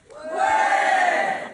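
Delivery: very high, airy mock crowd roar; crowd cheer. Workers acclaim the slogan, imitated crowd cheer. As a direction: imitate a hall erupting in cheers. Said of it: "tout le monde crie".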